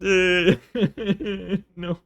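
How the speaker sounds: laughing no. Real, not acted. Laughs refusing the game.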